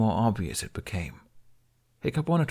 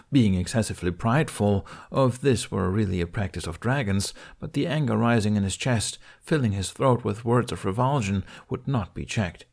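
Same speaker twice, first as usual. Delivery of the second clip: plain reading voice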